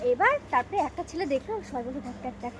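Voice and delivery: high, loud giggly storytelling; excited retelling. Real, not acted. Excitedly retelling boys staring. (উত্তেজিত হয়ে উঁচু গলায় গল্প বলা)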